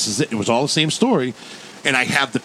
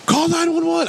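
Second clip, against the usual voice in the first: panicked plea. Retold or imitated. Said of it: "And she was like".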